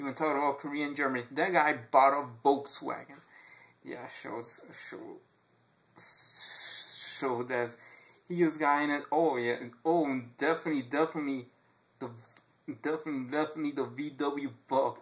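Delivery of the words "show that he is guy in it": ordinary talk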